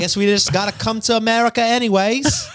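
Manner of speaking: put-on accent slides from Australian to British, laughing; bad Australian accent drifting British. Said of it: "That turned British quick".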